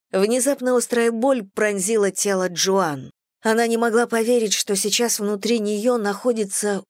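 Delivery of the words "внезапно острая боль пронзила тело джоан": usual speaking voice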